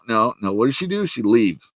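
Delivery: ordinary talk, nothing marked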